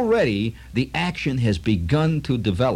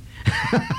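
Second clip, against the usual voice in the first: high laugh. Real, not acted. Laughing at own joke.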